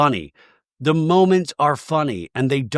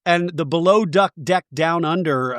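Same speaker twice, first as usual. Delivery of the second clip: ordinary delivery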